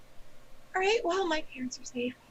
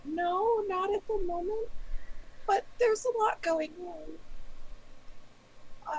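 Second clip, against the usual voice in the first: higher voice, close to crying; tearful; 7 semitones higher, much slower than usual. Acted. Raven tells her mother about the war, near tears. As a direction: hold back tears, slowly.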